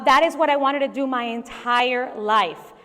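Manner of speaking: ordinary delivery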